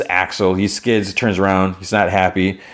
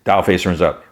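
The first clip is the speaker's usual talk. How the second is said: normal talking voice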